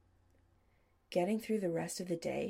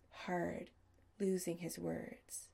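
ordinary delivery

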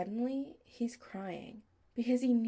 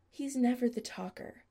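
ordinary delivery

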